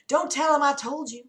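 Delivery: pleading. Acted. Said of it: "Dana pleaded".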